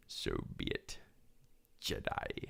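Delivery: deep, soft, near-whispered villain; Palpatine. Acted. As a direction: murmur it very low and sinister. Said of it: "Do your best Palpatine impression".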